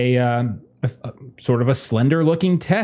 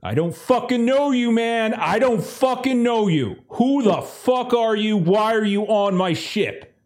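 high-pitched, agitated interrogation of strangers; angry disbelief, pitched well up; hostile armed crewman; 9 semitones higher than usual. Acted. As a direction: play a suspicious gunman refusing to know them. Lawrence rejects the crew.

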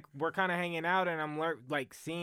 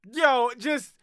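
excited; 10 semitones higher, 7 dB louder than usual. Real, not acted. Excited rant about podcast mode.

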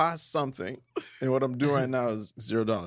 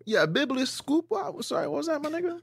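mock surprised; 13 semitones higher than usual. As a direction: squeak out fake disbelief. Mock confusion at big word.

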